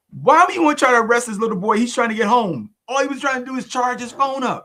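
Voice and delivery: high, loud, fast disbelief; indignant outburst. Real, not acted. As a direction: rant at the absurdity.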